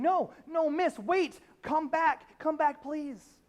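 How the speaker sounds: loud, high calling out, slowing on the last please; calling after her. Retold or imitated. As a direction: shout after the leaving woman to come back. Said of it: "The pastor runs down the aisle, chases after".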